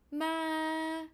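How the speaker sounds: long high level "ma"; high pitch. Shown as a demonstration. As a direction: hold "ma" up high without moving. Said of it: "your high pitch would be".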